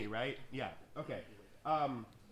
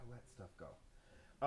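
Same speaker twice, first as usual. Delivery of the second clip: hushed; 9 semitones lower, 12 dB quieter, much slower than usual. Real, not acted. Life lesson, hushed.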